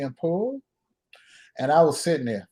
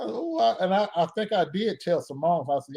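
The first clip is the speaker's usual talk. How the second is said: ordinary delivery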